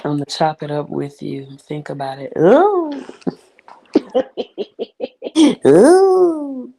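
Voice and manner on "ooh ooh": high squealing ooh with laughter; excited squeal. Real, not acted.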